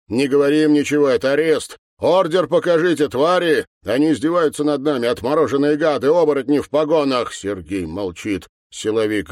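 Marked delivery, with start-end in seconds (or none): 0.1-7.3 loud, high-pitched, speeding-up rant; angry shouting. Acted. Mikhail raging at officers during his arrest, shouting. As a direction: rail at them, faster toward the end.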